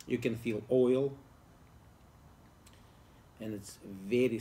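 cigar touching lips; speaking around a cigar; talking with cigar at lips. Real, not acted. Seen 1: Cigar held against lips while he talks through the stretch.

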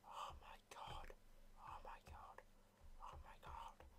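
awed whisper. Real, not acted. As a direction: whisper in amazement. Admiring his build.

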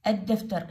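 الدفتر with a doubled d and no L; silent lam. Shown as a demonstration. Teacher repeats the word for pupils, lam silent. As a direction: say الدفتر as "ad-daftar". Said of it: "لا، ما تلفظ اللام".

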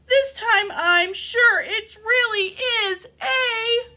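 high, slow, drawn-out alarm; loud frightened build-up; panicked shout. A narrator's reading. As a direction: shout a warning, stretching it into suspense. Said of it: "shouted Frida".

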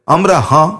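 plain reading voice

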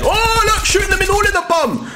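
high, excited gamer yell; excited outcry. Real, not acted. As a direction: call out the action in high-pitched excitement. Excited reaction to gameplay.

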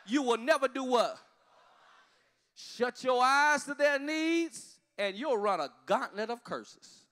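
loud, slow pulpit cry; preaching. Acted. Pastor to congregation, preaching at full force. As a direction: call it out to the whole church.

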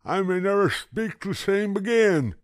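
high, loud gummy moan; moaning toothless grandpa. A narrator's reading. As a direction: whine it like a toothless old man.

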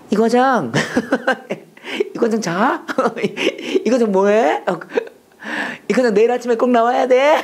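high, drawn-out late-night boss, breaking into laughs; hammed-up boss calling an underling, laughing between lines; wheedling boss. Boss phoning his manager at midnight, high wheedling call.

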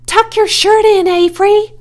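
high, loud scolding call; fussing woman, high-pitched shout; crying out. A narrator's reading. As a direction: call out sharply to a messy child. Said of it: "cried Missus Zuckerman".

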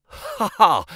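high, spoken ha-ha; acted laugh. Financial agent scoffing at the clerk starting Monday, acted laugh.

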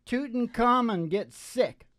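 ordinary delivery

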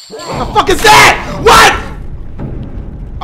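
sudden high yelp of shock; startled shout. Real, not acted. Player startled by a monster, shrieking high. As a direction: jumps out of his seat yelling.

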